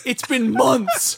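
high, slow, drawn-out complaint; comic fed-up cry; exasperated wail. Retold or imitated. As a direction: stretch it out in mock despair. Acted outburst at Alexa.

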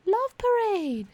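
Love Parade said slow and high; drawn out Love Parade; emphatic. Real, not acted. Emphatic naming.

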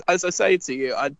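ordinary talk

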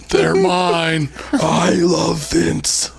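lightly voiced smug executive; John Laurinaitis impression. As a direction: play a cheeky wrestling boss lightly. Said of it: "I'm John Laurinaitis".

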